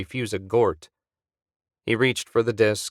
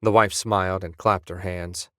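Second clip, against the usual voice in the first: usual speaking voice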